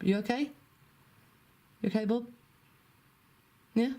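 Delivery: pet talk voice. Real, not acted. Checking on pet chicken.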